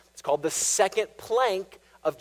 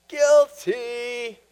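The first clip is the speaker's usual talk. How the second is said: sheepish, higher "Guilty"; a raised, mock-guilty admission; guilty confession; 7 semitones higher, 4 dB louder than usual. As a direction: own up like a caught sinner. Preacher acts out congregant confessing.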